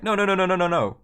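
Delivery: high, loud rapid-fire interruption; cutting in. Retold or imitated. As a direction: jump in urgently to stop the host. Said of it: "I cut him off".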